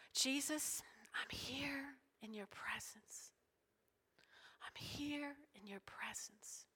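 slow, hushed prayer with long pauses; whispered prayer. Retold or imitated. Mother alone with Jesus, whispered prayer. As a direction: whisper it slowly, intimately to Jesus. Demonstrates her private prayer.

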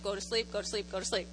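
put-on low, soft murmur to a baby; imitating a drowsy, quiet plea; hushed pleading. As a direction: repeat it under your breath, coaxing sleep. Grandmother murmuring to wakeful baby.

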